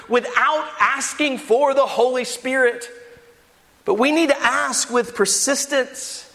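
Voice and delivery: high, urgent pulpit exhortation; impassioned preaching. Acted. Preacher urging the church to ask for the Spirit, impassioned.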